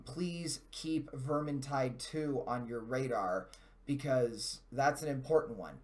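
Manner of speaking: usual speaking voice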